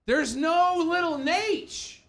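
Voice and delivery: high, stretched-out exclamation; drawn-out high-pitched crowing; excited call-out. Real, not acted. Calling out during live match.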